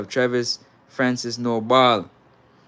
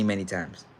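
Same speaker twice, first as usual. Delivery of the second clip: normal talking voice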